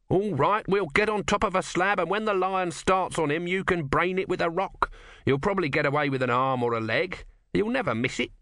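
high-pitched, wheedling little god; impatient small god. Acted.

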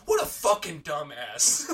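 loud, slow retold outburst; exasperated insult. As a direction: stretch the insult out in mock disgust. Retold insult about teammate.

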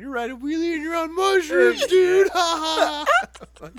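very high, very loud hysterical yell; imitated dying laughing. Rider imitates his laughing friend beside him, hysterical high shout. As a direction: shriek it as a friend cracking up. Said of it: "he's just dying laughing beside me".

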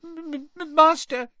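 very high-pitched frightened elf stammer. A narrator's reading. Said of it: "Winky stammered".

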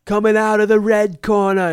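high, booming fight announcement; loud, high arena introduction; ring announcer shout. Acted. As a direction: bellow it like a wrestling announcer. Mock wrestler entrance announcement.

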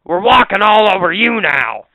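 rally the stepped-on specks in a comic revolt; mock rebel speck. Cohost voicing a speck of dirt that rises up, hammed-up rebel.

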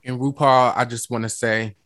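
usual speaking voice

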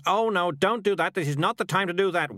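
mock compulsive outburst; involuntary outburst. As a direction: blurt it out as if uncontrollable. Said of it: "Whoops, there I go again".

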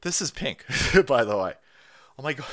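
normal talking voice

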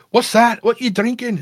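squeaky high showman calling to a drinker; faire performer. Retold or imitated.

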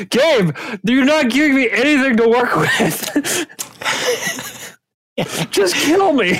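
high-pitched comic despair; hammed-up pleading frustration; mock exasperation. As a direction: whine at the game master, pitch way up. Player begs game master, exasperated.

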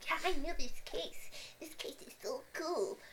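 high, put-on fangirl gushing; mock enthusiasm. Mimics people gushing about the case.

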